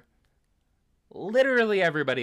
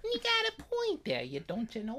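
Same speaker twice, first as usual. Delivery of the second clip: put-on laughing Milwaukee accent, much higher and softer; mock Milwaukee accent; 14 semitones higher, 10 dB quieter than usual. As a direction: copy the Milwaukee voice while laughing. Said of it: "like, a Milwaukee accent".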